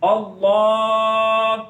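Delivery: अल्लाह with the second vowel held very long; drawn-out vowel. Shown as a demonstration. Teacher demonstrates the long vowel for students, drawn-out. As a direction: stretch the last vowel of अल्लाह, slowly. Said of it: "इसको लंबा करना है".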